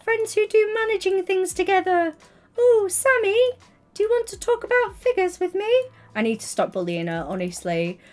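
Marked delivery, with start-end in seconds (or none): 0.0-2.1 high, sing-song teasing; mock teasing voice. Teasing streamer mocks her business-minded friend, high and sing-song. 2.6-5.7 high, louder sing-song teasing; mock gossip voice. Streamer teases her friend Sammy about figures, high sing-song.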